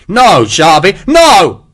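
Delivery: loud, high panicked scream of no; screaming plea. Acted. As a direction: beg for life, screaming. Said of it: "Hexwell screamed".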